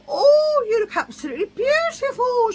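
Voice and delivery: high, loud, gushing woman's squeal; gushing high shriek. Retold or imitated. Stranger gushing over Lisa's butterfly sunglasses, high shrieking. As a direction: squeal the compliment like an overexcited stranger.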